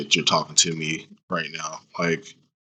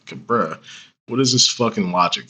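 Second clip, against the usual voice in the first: ordinary delivery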